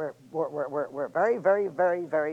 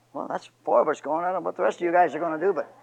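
usual speaking voice

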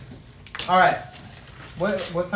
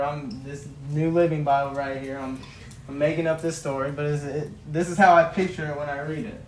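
ordinary talk

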